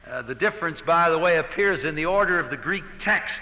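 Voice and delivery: normal talking voice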